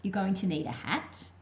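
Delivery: plain reading voice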